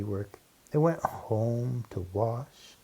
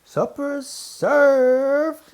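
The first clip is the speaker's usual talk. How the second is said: much higher, much louder, drawn-out call over the crowd; 10 dB louder, much slower than usual. Acted. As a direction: sing it out long to the whole hall. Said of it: "she called".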